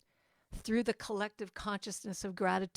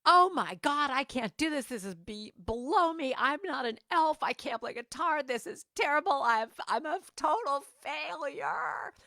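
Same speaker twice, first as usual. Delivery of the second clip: higher, whiny self-pity, slowing at the end; whiny wailing; 8 semitones higher than usual. Retold or imitated. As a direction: complain like a wailing child. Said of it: "the complaints started wailing from me".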